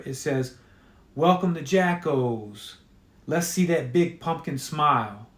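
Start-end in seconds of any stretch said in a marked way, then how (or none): none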